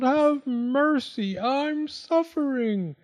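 high, slow wail of agony; suffering fox's drawn-out plea. A narrator's reading. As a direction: beg for mercy, stretching out the words. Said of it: "the fox wailed".

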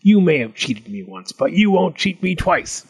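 mildly growling goblin; lightly voiced suspicious goblin; growled goblin warning. A narrator's reading. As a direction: insist with a touch of menace, rising at the end. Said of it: "he growled".

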